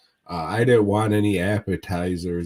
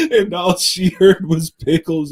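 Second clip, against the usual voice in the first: laughing; 7 semitones higher, 8 dB louder than usual. Real, not acted. Laughing while telling the mixup.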